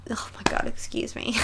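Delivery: breathless whispered aside, nearly no voice; hushed, overcome whisper. Real, not acted. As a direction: whisper it as if choked up by the song. Overcome, whispering mid-reaction.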